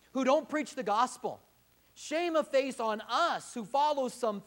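loud, driving sermon delivery; fiery preaching. Acted. Pastor exhorting his congregation, full-force preaching.

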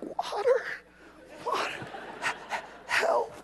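weak, high plea for water; parched traveler. Acted. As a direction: croak for help as if dying of thirst. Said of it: "I'm still working on getting rehydrated".

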